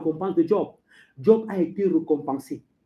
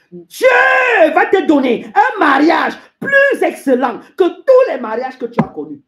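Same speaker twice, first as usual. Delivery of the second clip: shouted promise; 8 semitones higher, 10 dB louder than usual. Acted. Preacher promising a single believer a marriage, shouting. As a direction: thunder it out, easing off at the end. Promise repeated as loud proclamation.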